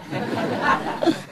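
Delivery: soft airy laugh at the punchline; breathy laugh. Real, not acted. Teller laughing at own punchline, soft airy laugh.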